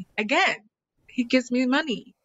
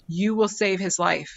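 ordinary talk

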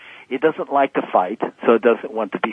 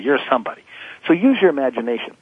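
ordinary talk

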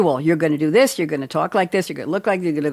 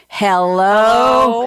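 loud hello; 4 semitones higher, 5 dB louder than usual. Real, not acted. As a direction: call out a big, loud hello to the audience. Host leads audience loud hello.

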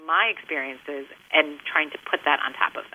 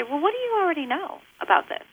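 put-on asking a child; 10 semitones higher than usual. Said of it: "And I say".

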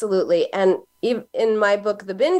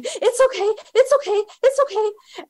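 much higher, frantic mock soothing; panicked mom; 13 semitones higher than usual. Mom digging in the diaper bag for her crying child, frantic high mock soothing. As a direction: chant it over and over, rattled.

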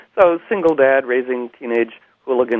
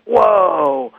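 alarmed whoa; 8 semitones higher than usual. Retold or imitated. Class reacting to gun.

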